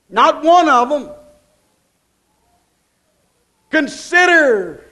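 rising, driving sermon cadence, hammering each repeat; preaching at full force. Acted. Preacher hammering the congregation about worry, full-force preaching. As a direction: preach it, pound every worrying.

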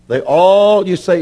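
ordinary delivery

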